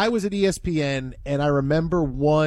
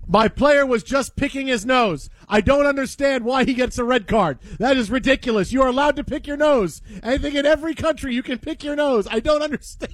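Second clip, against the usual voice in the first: mock outrage; 6 semitones higher than usual. Mock coach protesting red card.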